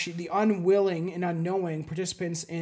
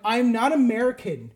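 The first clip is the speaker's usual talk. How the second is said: louder, firm, leaning on NOT; indignant correction; 4 semitones higher, 6 dB louder than usual. Retold or imitated. Answering commenters who think he's American, loud and indignant. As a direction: set the record straight, a bit exasperated.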